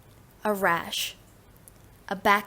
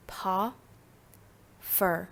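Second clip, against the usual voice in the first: normal talking voice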